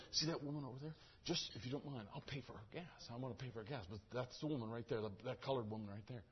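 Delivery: soft, low confidential undertone; hushed re-enacted aside to a clerk; discreet request. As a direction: say it privately so she can't hear. Replays his quiet request to attendant.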